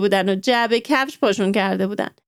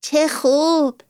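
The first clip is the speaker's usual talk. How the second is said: higher, slow delighted exclaim; a girl pleased with the news; mild happy cheer; 6 semitones higher, much slower than usual. A narrator's reading. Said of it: "با خوشحالی گفت".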